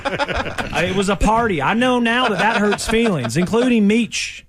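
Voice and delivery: slow, drawn-out Southern twang in a mock cop's voice; a lightly put-on Southern cop confessing; Southern drawl impression. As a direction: lay on a slow Southern accent, half-joking. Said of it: "sound like a Southern woman chef".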